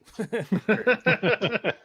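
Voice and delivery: loud, high peal of laughter; laughing. Real, not acted. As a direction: burst out laughing at your own joke. Laughs after own joke.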